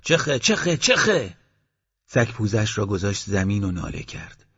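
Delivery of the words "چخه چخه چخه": high shout to drive the dog back. Acted. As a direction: yell sharply to send the dog off. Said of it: "داد زد".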